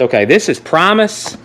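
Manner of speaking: ordinary talk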